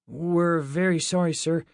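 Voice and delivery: apologetic. A narrator's reading. Gil apologises to an armed guard.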